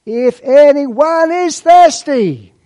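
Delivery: high, slow and loud, calling over a crowd; loud proclamation. Acted. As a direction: cry it out above the noise of the feast. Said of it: "Jesus said in a loud voice".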